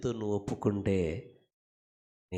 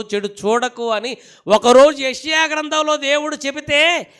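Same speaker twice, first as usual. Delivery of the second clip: full-force preaching; 13 semitones higher, 15 dB louder than usual. Real, not acted. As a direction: belt it out to the congregation.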